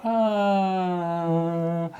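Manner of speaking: long "aah" sliding gradually down in pitch; wrong Doppler noise. Shown as a demonstration. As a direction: slowly glide down from a high held tone. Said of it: "and it doesn't go".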